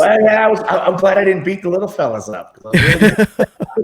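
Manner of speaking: laughing while talking. Real, not acted. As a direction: giggle through the words, slowing down. Laughing at his own story.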